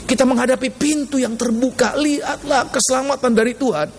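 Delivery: drawn-out opening, then high exhortation; preaching. Real, not acted. Preacher proclaiming the Lord's salvation, high drawn-out preaching. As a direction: stretch the first command, then press on high.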